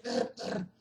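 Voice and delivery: "r, r" whispered as a tapped tongue r; "r" vibrante de Oslo; rolled r. Shown as a demonstration. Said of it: "Eles usam muito r".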